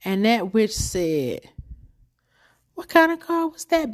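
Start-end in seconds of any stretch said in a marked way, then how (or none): 2.7-3.9 high, mocking condescension; mock-condescending question. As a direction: ask it down her nose, mocking. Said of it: "Being so condescending".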